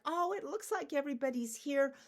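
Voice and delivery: high, loud anxious voice in a rush; panicky. Acted. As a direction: fret as you check the group. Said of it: "Everybody panics".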